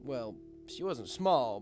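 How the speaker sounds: ordinary delivery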